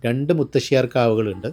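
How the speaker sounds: ordinary delivery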